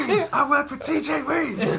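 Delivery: high, slow robotic cop declaration; RoboCop voice. Retold or imitated. Suggesting RoboCop to the hosts, slow high robotic line. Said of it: "more along the lines of RoboCop".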